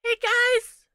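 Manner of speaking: put-on very high, loud squeaky kid; little kid voice. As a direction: squeak it out like a tiny child. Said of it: "a really little kid voice".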